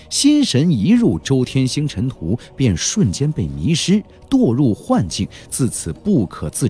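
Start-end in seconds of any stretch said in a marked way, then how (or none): none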